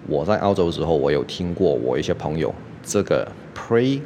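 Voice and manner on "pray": imitating a friend who says pray for play; merged R and L. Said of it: "他都念成pray".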